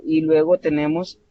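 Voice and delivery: ordinary delivery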